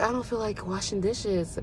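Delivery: soft, lazy I don't feel like it; reluctant whine. Retold or imitated. Woman avoiding the dishes after dinner, soft and reluctant. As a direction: sigh it out quietly and lazily. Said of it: "I just be like".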